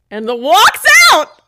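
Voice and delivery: high, drawn-out indignant yell; outraged shout. Real, not acted. Co-host outraged that he just walks out, shouting slowly. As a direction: explode in disbelief, stretching each word. Outraged at man leaving after sorry.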